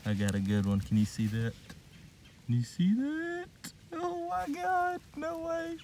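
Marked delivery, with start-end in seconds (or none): 2.4-5.8 very high, drawn-out squeal; amazed squeal. Real, not acted. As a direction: squeak it out slowly in disbelief. Stunned by a good find.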